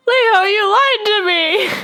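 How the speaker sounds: high, slow betrayed wail; hurt cry. Pretend. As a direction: cry out that he lied. Betrayed by raccoon Leo.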